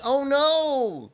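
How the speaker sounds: high, panicky inner wail; mock panic. Alone at the cinema with no English film, mock panic. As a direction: act out mild dread of the Thai-only movie. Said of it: "I was like".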